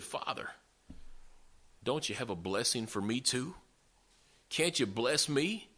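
soft, lifted pleading without real sobs; imitated tearful plea. Son begging his father for a blessing, mildly tearful. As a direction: plead gently, rising on the last question. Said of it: "He began to cry bitterly".

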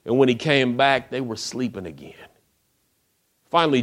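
normal talking voice

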